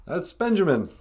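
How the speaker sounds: normal talking voice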